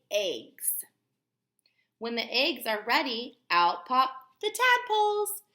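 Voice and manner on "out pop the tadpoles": much higher and louder, bursting out; excited. Acted. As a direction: make the tadpoles pop out with glee. Storytime reading to children.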